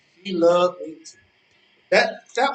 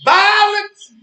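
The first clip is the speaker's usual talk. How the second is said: booming emphasis; 12 semitones higher, 7 dB louder than usual. Acted. Lecturing rival on violence.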